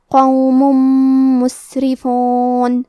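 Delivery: قوم مسرفون with tanween merged into doubled nasal meem; idgham with ghunna. Shown as a demonstration. Teacher shows merging with nasalisation, slow recitation. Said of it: "غنہ اور تشدید کے ساتھ ادا کریں گے".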